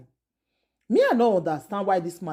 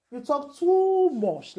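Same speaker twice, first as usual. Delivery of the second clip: slow, higher, drawn-out disbelief; exasperated scolding; 8 semitones higher, much slower than usual. Real, not acted. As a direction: lay it on slowly, fed up with him. Exasperated vlogger scolding the husband.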